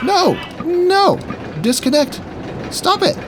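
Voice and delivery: high frantic shouts of no; shrill panicked cries at a glitching game; panicked yelling. Real, not acted. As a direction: yell at the game to stop.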